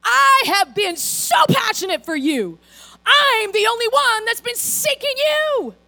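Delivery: Elijah's pity party. Retold or imitated. Elijah ranting to God in a loud, high self-pitying whine. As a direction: complain like a self-pitying prophet. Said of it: "He's like".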